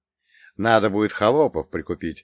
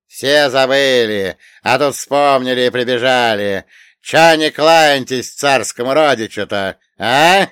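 loud, slow, slurred yelling; drunken shout; 4 semitones higher, 10 dB louder than usual. Acted. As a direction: bellow it at the guests, drunk. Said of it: "прикрикнул он".

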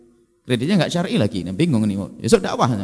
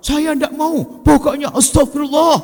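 higher showy protest; hammed-up pious refusal; mock shouting refusal; 13 semitones higher than usual. Ustadz showily refusing aid again, mock yelling. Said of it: "Ngapain teriak-teriak begitu?".

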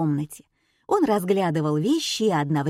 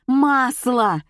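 high, loud yell; 6 semitones higher, 5 dB louder than usual. Acted. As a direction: yell it out in exasperation. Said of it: "завопила ведущая". (громкий вопль)

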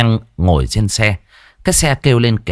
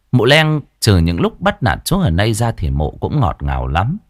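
ordinary delivery

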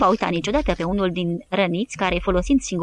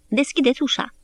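resigned; 5 semitones higher than usual. A narrator's reading. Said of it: "spuse cu resemnare".

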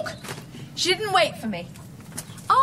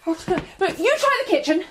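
normal talking voice

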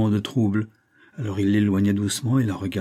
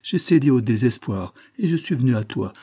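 usual speaking voice

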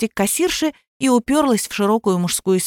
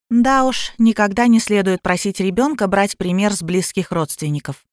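ordinary delivery

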